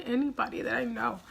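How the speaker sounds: ordinary delivery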